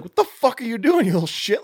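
high-pitched mock outrage; freaking out. Joyce catching Curtis slathering the cake, mock freak-out. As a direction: act out flipping out on a kid. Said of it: "she freaks out on him".

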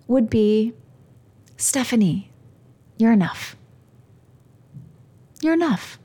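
tearful, halting words to oneself; real choked-up tenderness; emotional. Real, not acted. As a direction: speak through welling emotion, long pauses between phrases. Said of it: "I might get emotional".

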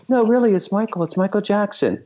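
very high-pitched imitated phone caller. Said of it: "I hear this voice, and he goes".

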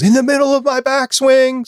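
high-pitched imitation of a protesting yell; mock indignant yell. Golfer interrupted mid-swing in a retold scene, mock indignant yell.

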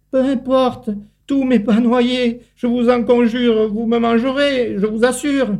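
high, begging little ant; crying out for help. A narrator's reading. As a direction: cry out to the spider for rescue. Ant voiced in story.

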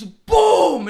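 loud, drawn-out shouted boom; explosive shouted sound effect; imitated impact. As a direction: boom it out like a big crash. Imitating the head slamming the table.

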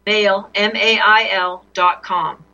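slow, careful letter by letter; dictating. Real, not acted. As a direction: spell each letter out clearly. Finishing the dictated email address.

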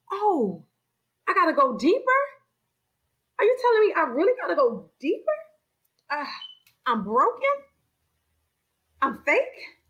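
each realization hits harder, rising to a cry; growing dismay. Retold or imitated. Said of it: "And I said".

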